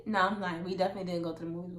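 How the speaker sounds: ordinary talk, nothing marked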